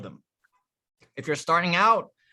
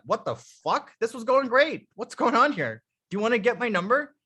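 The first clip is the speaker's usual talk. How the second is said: higher, put-out disbelief; indignant protest; 7 semitones higher than usual. Acted. Girl to the man walking away, indignant protest. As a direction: protest as if baffled by the brush-off.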